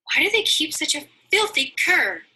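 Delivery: high, loud frightened cry; affrighted cry. Acted. Mercy hears the hideous barking, frightened high cry. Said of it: "much affrighted".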